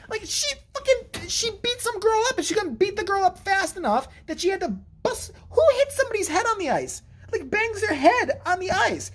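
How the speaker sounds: very high-pitched incredulous ranting; appalled disbelief. Real, not acted. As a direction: marvel in squeaky disbelief at the violence. Appalled at the girl's violence.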